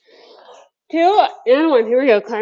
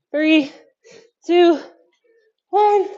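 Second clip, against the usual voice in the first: calling out countdown; 7 semitones higher, 4 dB louder, much slower than usual. Real, not acted.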